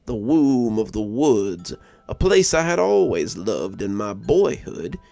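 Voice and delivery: usual speaking voice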